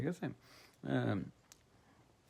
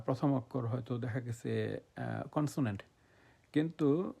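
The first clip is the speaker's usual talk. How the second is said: normal talking voice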